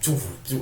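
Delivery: soft, quick "choo, choo" zap; generic blaster imitation. Shown as a demonstration. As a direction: voice a bland laser shot. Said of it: "but these are more like choo".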